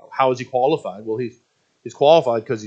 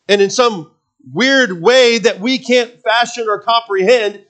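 loud, higher, urgent sermon voice; full-force preaching; 8 semitones higher, 7 dB louder than usual. Acted. Preacher presses the question to the congregation, loud and urgent. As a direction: preach it out to the back row.

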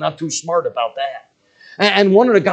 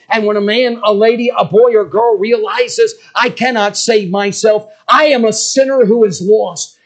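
loud, higher, fervent preaching; preaching at full force; 6 semitones higher, 7 dB louder than usual. Acted. The preacher calling the crowd to repentance, loud and fervent. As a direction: drive it home to the congregation.

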